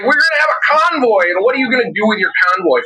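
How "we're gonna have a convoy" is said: pitched way up, goofy and eager; mocking imitation. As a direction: make the convoy guy sound silly. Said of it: "One guy was like".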